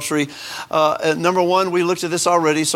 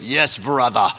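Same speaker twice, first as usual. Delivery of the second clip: lower-pitched imitation of an Australian accent; George Francis's Australian accent; 4 semitones lower, slower than usual. Recalling a missionary for the congregation, imitated Australian accent. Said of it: "He had an accent".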